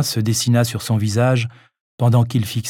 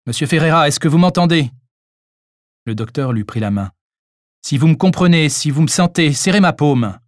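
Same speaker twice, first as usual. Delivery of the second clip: louder, higher call to an unconscious man; 4 semitones higher, 4 dB louder than usual. Acted. Doctor calls to the collapsed old man, insistent. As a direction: call him back, clear and insistent.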